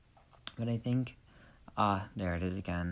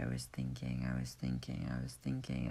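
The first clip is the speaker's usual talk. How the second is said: softer repeated mumble; quiet murmur; 10 semitones lower, 6 dB quieter than usual. Shown as a demonstration. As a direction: trail off quietly into thought. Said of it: "I was thinking the other day".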